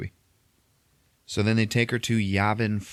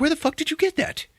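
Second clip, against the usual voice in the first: shocked outburst. Retold or imitated. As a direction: blurt it out in disbelief. Pilot shocked she has blaster.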